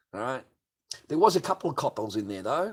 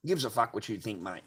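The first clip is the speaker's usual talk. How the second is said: usual speaking voice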